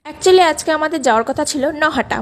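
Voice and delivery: ordinary delivery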